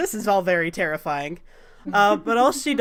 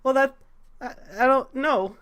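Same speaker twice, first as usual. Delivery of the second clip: higher, halting reluctant reply; flustered; 4 semitones higher than usual. Acted. Sal pressed to face his memories, flustered. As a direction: stumble over it, mildly anxious.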